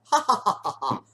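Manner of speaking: big, high "ha ha ha" laugh; hammed-up belly laugh; loud mock laugh. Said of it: "I'm laughing out loud".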